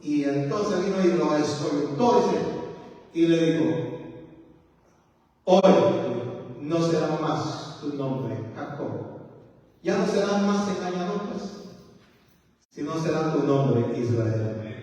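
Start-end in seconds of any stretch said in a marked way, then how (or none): 5.4-9.2 voice of God. Acted. God renaming Jacob, low and slow. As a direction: pronounce it slowly like a solemn decree.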